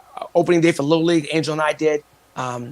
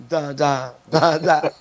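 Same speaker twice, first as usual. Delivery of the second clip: tongue-tied babble; 4 dB louder, much slower than usual. Retold or imitated. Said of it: "I was like".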